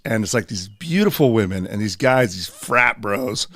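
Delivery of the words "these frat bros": ordinary delivery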